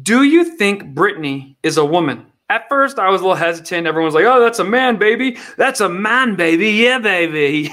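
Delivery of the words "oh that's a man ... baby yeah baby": higher, groovy, swaggering; hammed-up Austin Powers. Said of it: "Austin Powers voice".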